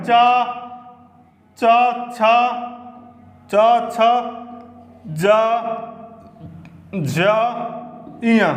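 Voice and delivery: teacher voicing the palatal letters; demonstrating palatal sounds. Shown as a demonstration. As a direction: sound out each letter separately. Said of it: "कौन कौन से वर्ण हैं".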